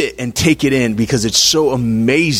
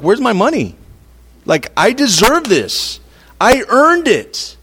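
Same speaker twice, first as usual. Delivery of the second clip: mock indignant whine, pitched higher; entitled demand; 7 semitones higher than usual. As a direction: play the entitled worker demanding his pay. Entitled employee demanding late pay.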